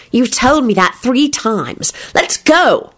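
put-on slow exasperated girl voice; impatient. A narrator's reading. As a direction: drag it out, exasperated. Impatient Rosie.

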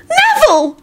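extremely high-pitched outraged cry; scream. A narrator's reading. Ginny turning on Neville, screaming. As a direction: shriek the name in outrage. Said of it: "Ginny screamed".

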